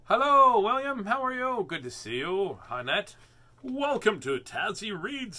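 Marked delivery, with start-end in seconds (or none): 0.1-1.6 loud greeting. Real, not acted. Greeting viewers loudly on stream.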